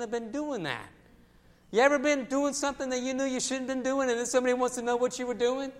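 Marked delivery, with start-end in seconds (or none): none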